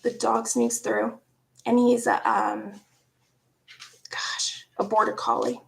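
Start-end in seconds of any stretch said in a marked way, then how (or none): none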